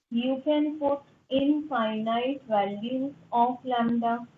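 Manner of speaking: very slow, deliberate teacher read; slow, dictating teacher; slow dictation. Shown as a demonstration. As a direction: spell it out word by word for notes. Teacher dictates definition slowly.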